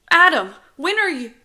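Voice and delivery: put-on very high, loud kid voice; exasperated child. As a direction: demand attention like a little brother. Child nagging louder as ignored.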